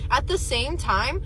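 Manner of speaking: ordinary talk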